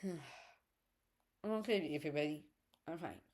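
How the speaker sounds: quietly pulling herself together; after crying. Real, not acted. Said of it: "Sorry for the little teary eye".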